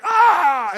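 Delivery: a shrill yowling cat scream, very high and loud; mock cat scream. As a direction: screech like a cat in heat. Imitating screaming cats.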